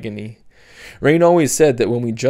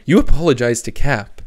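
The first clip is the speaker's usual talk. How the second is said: louder, shocked order; horrified demand; 7 dB louder than usual. Acted. Mother ordering Sophie to apologize, shocked and louder. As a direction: insist in dismay. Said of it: "her mother exclaimed in horror".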